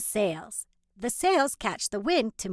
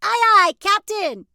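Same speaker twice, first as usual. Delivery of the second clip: loud high aye aye captain; eager pirate shout; 10 semitones higher, 10 dB louder than usual. Acted.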